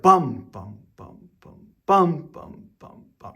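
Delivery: each bum punched with extra oomph; accented beats. Shown as a demonstration. Piano teacher sings accents to her student, punching each bum. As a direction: hit every "bum" hard like an accented note. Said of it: "that's what an accent is gonna sound like".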